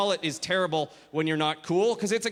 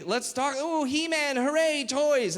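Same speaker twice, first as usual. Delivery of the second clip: put-on high, excited child voice; happy little kid; 6 semitones higher, slower than usual. Comedian as his younger self with toys, happy little kid. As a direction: gush over toys like a small child. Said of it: "One day I was happy".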